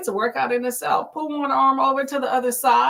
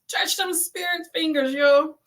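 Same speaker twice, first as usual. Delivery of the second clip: usual speaking voice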